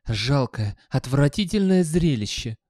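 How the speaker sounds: contemptuous, rising on the second adjective; scornful. A narrator's reading. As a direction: sneer at a pitiful sight. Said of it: "презрительно повторил Гаспод".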